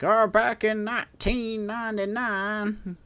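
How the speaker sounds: put-on high, slow quavering old-timer; old person voice. Shown as a demonstration. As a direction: reminisce like a creaky grandpa. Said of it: "like, an old person voice".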